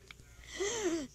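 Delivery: sharp surprised gasp; excited gasp. Real, not acted. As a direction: gasp at the find. Finds diamond.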